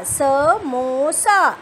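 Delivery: Samosa drawn out very slowly, each syllable held; slowly drawn-out samosa for a reading class; stretched teaching word. Shown as a demonstration. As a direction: lengthen samosa so children hear the ओ. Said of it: "और last word".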